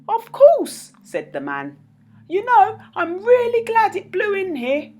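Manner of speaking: high, meek little man's voice; timid little man. Acted. Mister Bashfellow explains his machines to the kids, high and timid. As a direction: confess shyly.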